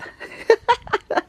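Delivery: very high giggle; real high-pitched laughter; giggling. Real, not acted. Laughs at own face in snow.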